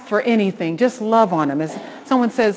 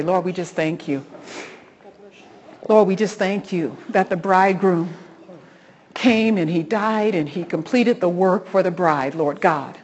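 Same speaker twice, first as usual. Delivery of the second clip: earnest prayer rising louder at the end; closing prayer; 4 dB louder than usual. Acted. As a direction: lead them in prayer with growing fervor. Host leads closing prayer.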